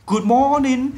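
"Good morning" said high and loud, clearly articulated; teacher modelling "Good morning" for learners; carefully voiced "Good morning"; model pronunciation. Shown as a demonstration. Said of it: "hướng dẫn các bạn nói cho chuẩn".

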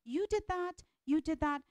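high accusing jabs, fading; finger-pointing blame. Shown as a demonstration. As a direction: blame him item by item. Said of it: "we start pointing fingers".

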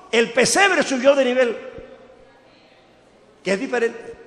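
fiery preaching. Acted. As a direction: preach it out to the whole church. Preaching at full force. (predicación encendida, fuerte y aguda)

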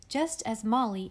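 plain reading voice

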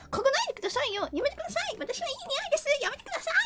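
high squealy pretend protest; playful high whining plea; mock protest. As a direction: squeal at someone sniffing you.

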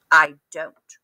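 sulky grumpy duck. A narrator's reading. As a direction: grump it out flatly. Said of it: "grumped Duck".

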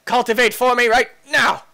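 high, loud command; mock demand. Player demanding work from the machine, mock demand. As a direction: order it about at a shout.

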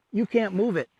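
normal talking voice

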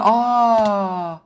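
tiz, gür, uzun bir "aa" çığlığı; şaşkın bağırış. Acted. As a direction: sahte bir şokla haykır. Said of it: "Çok ani değiştim değil mi?". (şaka öfkenin sonunda tiz çığlık)

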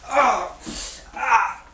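ordinary talk

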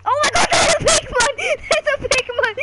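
high, loud excited shriek; excited squeal. Real, not acted. Child to dad, spotting a big mob, excited squeal. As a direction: squeal it out in thrilled panic. Kid panics at a Minecraft mob.

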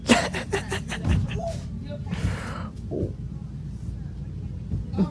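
high, soft, airless wheeze of a laugh; breathless wheezing laugh. Real, not acted. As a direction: lose it into a silent, high wheeze. Cracking up at a clip.

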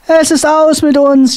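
high, lamenting cry; wailing. A narrator's reading. Sailor Mart laments that all is lost. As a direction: wail in despair. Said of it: "jammerte der Mart".

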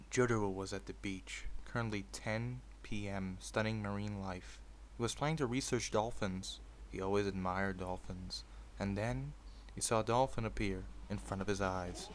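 story narration. A narrator's reading. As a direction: read it like a bedtime fanfic. Reads fanfic aloud.

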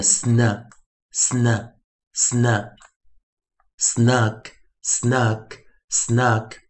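sn as one blend "snuh", then snug; slow, spaced drill from sn "snuh" to snug; three separate sn blends, then three of snug; phonics blending. Shown as a demonstration. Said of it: "我们就念".

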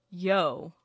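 usual speaking voice

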